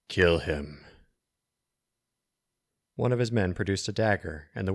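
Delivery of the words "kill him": cold wizard command. A narrator's reading. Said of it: "spoke in a whisper that cut through the noise".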